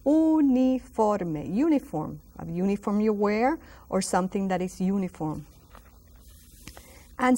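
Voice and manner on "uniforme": uniforme, slow, U said as a pure oo; Spanish U like oo. Shown as a demonstration. Teacher showing the Spanish U: uniforme. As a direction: draw out uniforme with an oo sound. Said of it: "almost like double O pronunciation".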